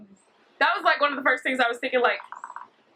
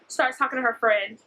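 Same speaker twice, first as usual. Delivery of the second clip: usual speaking voice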